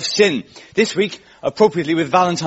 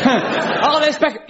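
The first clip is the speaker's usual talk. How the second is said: high, delighted gasp of recognition; light mock surprise at a shirt; mock excited spotting; 11 semitones higher, slower than usual. Comedian imitating himself spotting a fake Beckham, mildly excited. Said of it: "I've been caught out so many times".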